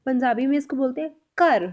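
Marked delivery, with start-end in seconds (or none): 1.4-1.6 कर with a plain k, no breathy gh; teacher models Punjabi कर instead of Hindi घर; Punjabi kar. Shown as a demonstration. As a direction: say कर, not घर, hard unaspirated k. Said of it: "Hindi में हम बोलते हैं घर".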